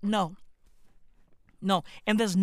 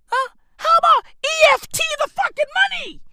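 much higher, fast exasperated squeal; squealing rant; 7 dB louder, faster than usual. Real, not acted. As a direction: shriek it in disbelief. Ranting at a stranger, pitch jumps.

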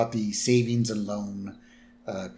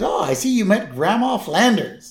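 Ned Flanders voice; 9 semitones higher, 5 dB louder than usual. Retold or imitated. Ned voiced introducing grandma.

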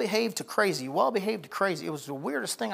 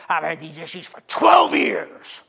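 distressed shout; 8 dB louder, slower than usual. Retold or imitated. Distressed man quoted in story.

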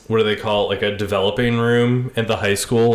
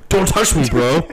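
touchy jock; 5 semitones higher than usual. Acted.

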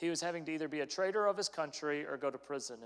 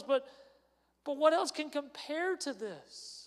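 higher, rising with growing conviction; encouraging wife; 7 semitones higher, 4 dB louder than usual. Retold or imitated. Wife to Peter, urging him to follow, rising encouragement. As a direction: build her husband's courage line by line. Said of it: "bolstering his faith".

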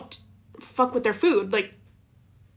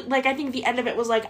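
ordinary talk, nothing marked

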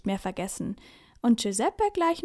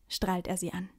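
ordinary delivery

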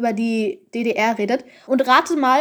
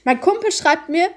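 ordinary delivery

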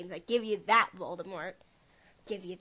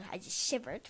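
hushed, breathy whisper; 6 dB quieter than usual. Real, not acted. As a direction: whisper as if spooked. Creeped out, whispering.

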